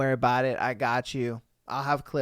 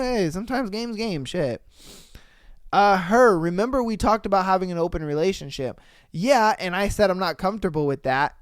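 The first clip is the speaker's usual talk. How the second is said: usual speaking voice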